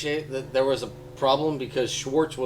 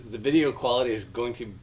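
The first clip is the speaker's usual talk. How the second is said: ordinary delivery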